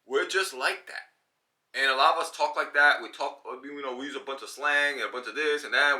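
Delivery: ordinary talk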